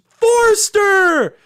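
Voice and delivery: very high, loud shouted last name; shouting a surname. Retold or imitated. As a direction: bellow his surname back across the stage. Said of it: "he goes".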